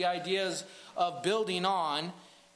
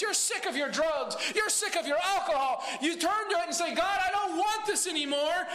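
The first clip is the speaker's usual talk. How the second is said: preaching at full force; 7 semitones higher than usual. Acted. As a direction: build the fire of the sermon. Sermon climax, shouting.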